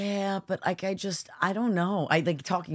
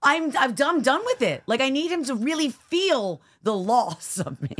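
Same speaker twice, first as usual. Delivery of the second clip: worked up; 8 semitones higher, 4 dB louder than usual. Real, not acted. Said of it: "admits anger".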